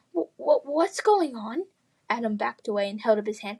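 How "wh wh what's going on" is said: stammering fright. Acted. Adam stammering scared.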